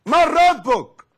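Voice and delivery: booming interrogation from the grave angel; thundering angel's shout. Acted. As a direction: bellow it like thunder shaking the dead man. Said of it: "هو ده زعيق؟".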